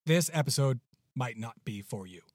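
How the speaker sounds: plain reading voice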